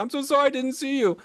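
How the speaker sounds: high, put-on woman's voice, earnest apology; imitating apologetic woman. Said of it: "she was genuinely apologetic".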